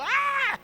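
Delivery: very high yelp of clamour; brief high-pitched mock scream; imitated shouting. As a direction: cry out like a praying crowd. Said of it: "orando, gritando".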